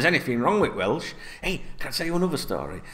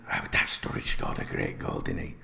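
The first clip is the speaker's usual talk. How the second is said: whispered aside; 8 dB quieter than usual. Real, not acted. Aside reading while whispering.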